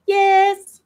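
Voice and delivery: high, loud cheer; excited yes. Retold or imitated. Cheering a fan comment in a high, loud voice. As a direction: burst out like a cheerleader. Molly Shannon reference.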